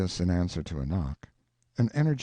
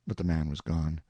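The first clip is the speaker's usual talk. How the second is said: ordinary delivery, nothing marked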